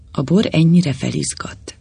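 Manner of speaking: ordinary delivery, nothing marked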